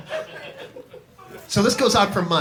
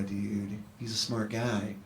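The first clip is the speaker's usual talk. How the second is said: ordinary talk, nothing marked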